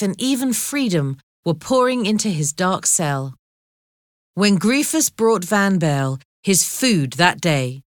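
plain reading voice

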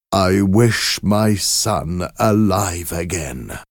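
slow, weighty stress on wish; slow solemn wish. Acted. Old man makes the fateful wish, slow and solemn. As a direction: speak the wish slowly and gravely. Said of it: "raised his hand and said".

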